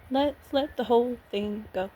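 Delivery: ordinary talk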